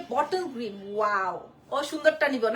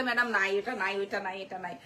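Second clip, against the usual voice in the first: lower, softer, fading mock of the assistant; mock assistant excuse; 7 semitones lower, 6 dB quieter than usual. Seller mimics her assistant refusing clients, softly lower. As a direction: mimic the helper brushing off clients, dropping away. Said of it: "client চাইলে বলে".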